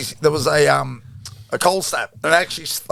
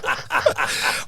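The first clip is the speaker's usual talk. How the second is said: laugh. Real, not acted. Laughing at roommate story.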